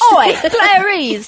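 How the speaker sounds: loud slow Cockney voice; Cockney accent. Retold or imitated. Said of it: "British accent".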